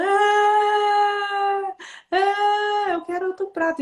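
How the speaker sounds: long, high imitated wail into words; mock crying child. Mother imitates her son crying for another plate, whiny wail. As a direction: bawl like a toddler, hammed-up. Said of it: "e chorando".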